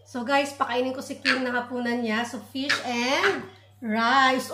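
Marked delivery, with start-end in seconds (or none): none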